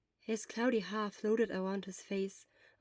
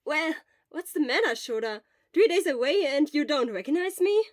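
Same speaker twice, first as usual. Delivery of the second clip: Obo's voice; 6 semitones higher, 10 dB louder than usual. Acted. Obo cheerfully teasing.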